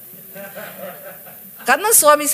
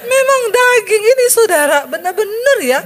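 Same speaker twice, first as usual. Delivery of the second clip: exclaiming; 8 semitones higher, 5 dB louder than usual. Real, not acted. Exclaiming at the flesh.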